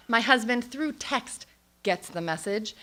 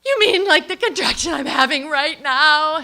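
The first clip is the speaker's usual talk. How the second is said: high, loud mock outburst; hammed-up indignant shout, pitched up; strained retort; 6 semitones higher, 11 dB louder than usual. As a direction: blurt it through a contraction. Retort to midwife during contraction.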